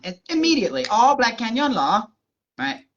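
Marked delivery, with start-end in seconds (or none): 0.9-2.1 put-on slow, drawn-out Thai-accented realization; the penny drops, in a higher Thai-accented voice. Imitating Thai lady finally understanding.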